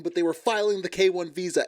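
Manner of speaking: ordinary delivery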